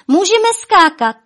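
high, loud excited shout. A narrator's reading. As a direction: yell it out with glee. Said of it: "křičela Miluška".

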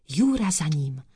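ordinary delivery

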